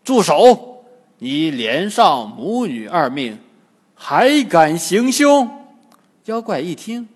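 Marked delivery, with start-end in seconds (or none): 0.0-5.5 loud stern command to stop; angry scolding, louder, slowing at the end; stern shout. A narrator's reading. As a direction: bark the order to halt. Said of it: "被唐僧喝住".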